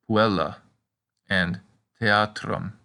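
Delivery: "puella" stressed on EL, "theatrum" on A, slowly; stress demo. Shown as a demonstration. Teacher showing stress on heavy syllables. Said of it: "two following consonants can also make".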